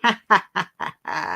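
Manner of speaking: playful witch cackle. Pretend. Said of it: "It's a cackle".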